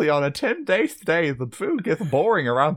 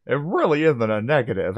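ordinary talk, nothing marked

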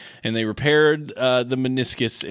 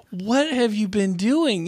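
mock scolding doctor; 9 semitones higher, slower than usual. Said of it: "they're like".

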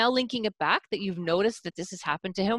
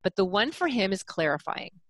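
ordinary talk, nothing marked

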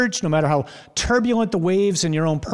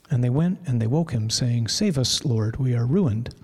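stiff Mass reading; 5 semitones lower than usual. Retold or imitated. Preacher to congregation, mocking the usual Mass reading, solemn and stiff. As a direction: read it like the polite, stiff Sunday version. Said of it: "This is how we read it usually".

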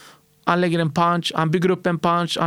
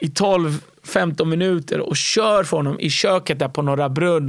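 usual speaking voice

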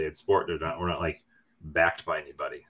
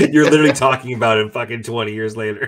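loud, fast, through real laughter; laughing tease; 4 semitones higher, 9 dB louder, faster than usual. Real, not acted. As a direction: rib a friend, cracking up as you talk. Teasing friend while laughing.

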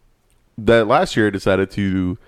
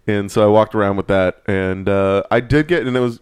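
ordinary delivery

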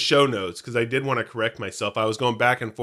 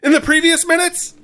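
high, loud show-opener announcement; big, high-pitched announcer intro; recap announcer; 7 dB louder than usual. Acted. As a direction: kick off the recap with a raised voice. Opens the episode recap.

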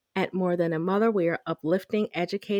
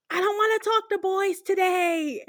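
put-on much higher little-girl voice, blunt; imitating toddler; 10 semitones higher than usual. Toddler daughter telling the store man off, high child voice. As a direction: play a stubborn two-year-old.

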